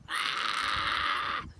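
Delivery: sharp breathy shriek; a startled yelp; goblin scream. Acted. Goblin prince spots a human and screams. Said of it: "Grogber screamed".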